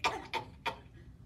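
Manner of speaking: short acted coughing; pretend cough. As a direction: cough like the sick bear. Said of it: "and he coughs".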